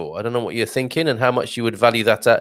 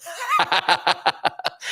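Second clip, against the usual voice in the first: sudden high laugh, amused; a real, high giggle at the comment; delighted high laugh; high burst of laughter; 14 semitones higher, 3 dB quieter than usual. Real, not acted. Reacting to the Chicago comment.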